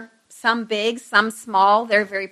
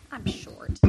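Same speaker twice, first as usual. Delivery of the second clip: quick under-the-breath whisper to the room; whispered aside; 13 dB quieter than usual. Real, not acted. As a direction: drop to a whisper for a private aside. Whispered aside about her height.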